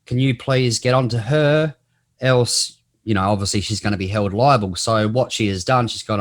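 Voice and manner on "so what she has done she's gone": usual speaking voice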